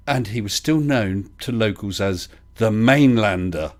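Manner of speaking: normal talking voice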